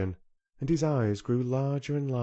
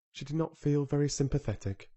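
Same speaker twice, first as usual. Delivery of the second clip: plain reading voice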